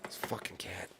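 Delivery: whispering about the cat; exasperated whisper. Real, not acted. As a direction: breathe it out in annoyance.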